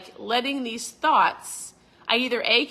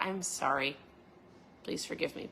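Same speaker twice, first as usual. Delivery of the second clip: much softer and lower; humble apology; 5 semitones lower, 9 dB quieter than usual. Shown as a demonstration. Apologizing to a boss or friend, soft and low. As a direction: say sorry gently and quietly.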